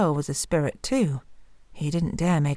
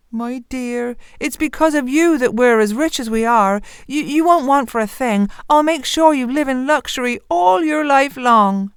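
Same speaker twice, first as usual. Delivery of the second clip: higher and louder, eager miller; doting rich father; 7 semitones higher, 5 dB louder than usual. Acted. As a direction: promise your daughter luxury. Miller promises riches.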